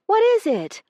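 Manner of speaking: high, loud jolt awake; startled out of sleep, yelp it. A narrator's reading. Io woken by banging, yelps startled. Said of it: "Io yelped".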